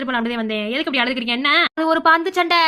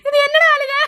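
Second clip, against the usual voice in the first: loud high exclamation; surprised exclaim; 10 semitones higher, 6 dB louder than usual. Acted. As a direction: cry out in surprise at the crying. Mother exclaiming at crying child. (அழும் மகனைப் பார்த்து அம்மா உரக்க ஆச்சரியம்)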